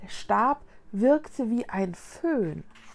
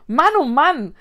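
loud, higher delighted exclamation; enthusiastic; 5 semitones higher, 10 dB louder than usual. A narrator's reading. Child watches a cake grow, enthusiastic. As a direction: cry out with glee. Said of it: "rief Vicky begeistert".

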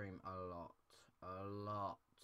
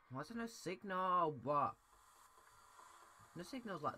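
much higher, louder indignant outburst; outraged disbelief; 10 semitones higher, 8 dB louder than usual. Real, not acted. Gamer shouting at a TV with no signal. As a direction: protest at the broken TV.

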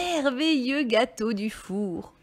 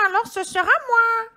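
high-pitched squawking hen; little hen voice; 13 semitones higher, 7 dB louder than usual. A narrator's reading. Hen deciding to do it herself, high hen voice. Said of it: "dit la petite poule rousse".

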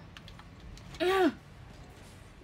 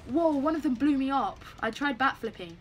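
usual speaking voice

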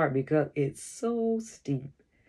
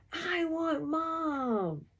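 put-on high, drawn-out childlike wail; wailing for Mom; 12 semitones higher, much slower than usual. A narrator's reading. Stung-scared child running home to Mom, wailing. Crying child runs from bees.

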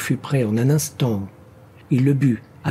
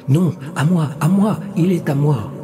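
higher, insistent counterclaim; rival claiming voice; 5 semitones higher, 3 dB louder than usual. A narrator's reading. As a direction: argue back as a competing spirit. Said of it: "dit une autre voix".

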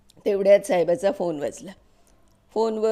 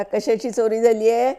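a loud, rude bark at a poor woman; barking policeman; 3 semitones higher, 7 dB louder than usual. A narrator's reading. Gruff policeman barks at a frightened mother. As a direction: snap the question out loud, like a gruff constable. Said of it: "जोरात खेकसून तो म्हणाला".

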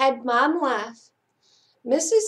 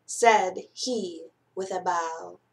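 ordinary delivery

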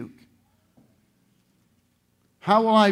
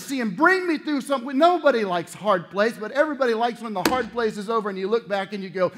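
normal talking voice

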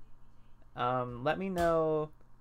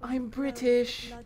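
put-on British accent; 7 semitones higher, much slower than usual.

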